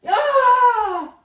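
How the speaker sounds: loud, high tearful wail; crying wail. Real, not acted. Writer crying on camera, loud frustrated wail. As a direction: wail out in frustration through tears. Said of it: "embarrassing to cry on camera".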